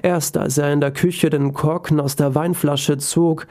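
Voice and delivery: ordinary delivery, nothing marked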